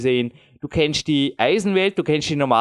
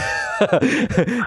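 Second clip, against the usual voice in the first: laugh; 8 semitones higher than usual. Real, not acted. Laughs at own weakness.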